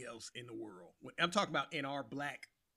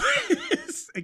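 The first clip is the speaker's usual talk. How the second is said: loud laugh; 9 dB louder than usual. Real, not acted. Laughs at failed accent.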